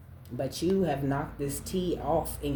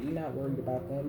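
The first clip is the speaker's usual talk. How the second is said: usual speaking voice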